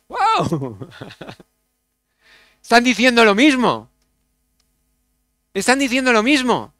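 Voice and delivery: bursting into a laugh, loud and high; delighted laugh at the discovery. Real, not acted. Preacher to congregation, laughing with delight.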